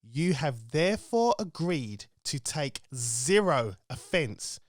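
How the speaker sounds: deadpan announcer warning. Acted. Show intro disclaimer read.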